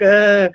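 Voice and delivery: loud, high "ah" of crying; mock wail. Bar back finally breaking down at the top bar, mock wailing. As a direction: wail it out in a hammed-up sob. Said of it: "just, like, wailing, like".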